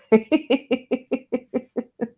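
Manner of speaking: real high-pitched laugh. Real, not acted. Streamer reading a chat comment, breaks into a high laugh. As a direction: laugh it off, embarrassed. Laughing at own embarrassing story.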